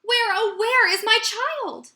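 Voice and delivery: put-on Sal's mother panicking over her missing child; alarmed cry. A narrator's reading. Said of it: "turned around and gasped".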